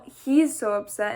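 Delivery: usual speaking voice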